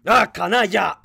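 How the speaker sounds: loud, high angry curse; enraged outburst; cursing. A narrator's reading. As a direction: spit out a furious insult. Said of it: "se agachó maldiciendo".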